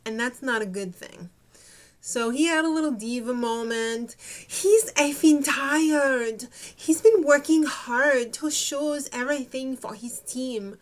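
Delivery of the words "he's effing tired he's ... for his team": hammy Spanish accent impression; bad Spanish accent. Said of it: "Sorry if I did a very bad Spanish accent".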